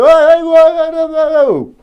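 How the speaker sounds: high, loud hooting cries; mock war cries. Prisoner hooting at the crowd around him. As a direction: hoot like a frantic captive.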